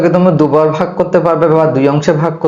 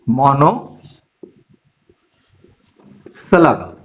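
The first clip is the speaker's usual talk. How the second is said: list dictation; 4 semitones lower, slower than usual. Real, not acted. Teacher listing four syllable types for students, slow dictation. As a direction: lower voice, item by item with long pauses.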